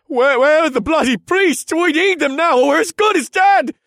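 high, loud frantic yell; panicked shouting. Acted. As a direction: scream for help in terror. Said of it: "in sheer panic".

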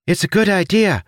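high, odd little creature voice; chirpy winged creature, helpful. Acted.

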